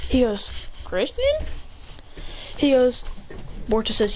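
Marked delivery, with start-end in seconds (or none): 0.8-1.4 very high rising question; acted surprise. Transfer student recognising an old friend, high surprised voice. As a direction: lift the name way up questioningly.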